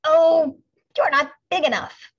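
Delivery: high, loud dismissive brush-off; scornful ladybug. A narrator's reading. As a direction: wave them off with contempt. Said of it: "said the grouchy ladybug".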